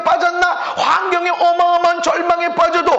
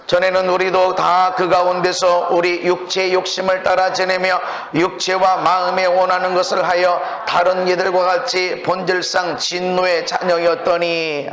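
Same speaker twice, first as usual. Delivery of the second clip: scripture reading; 8 semitones lower than usual. Acted. Reads scripture aloud.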